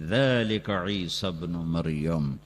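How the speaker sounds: slow, low Arabic recitation; Quranic recitation. Acted. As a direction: intone it slowly like a qari. Recites the closing verse.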